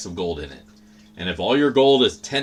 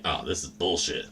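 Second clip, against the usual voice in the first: unhappy grumble; 6 semitones lower, 7 dB quieter than usual. Retold or imitated. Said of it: "you can hear it in my voice".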